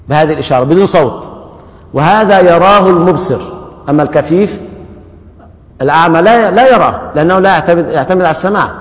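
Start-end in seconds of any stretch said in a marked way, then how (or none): none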